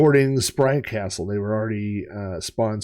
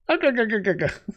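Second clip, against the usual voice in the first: leprechaun cackle; 11 semitones higher than usual. Pretend.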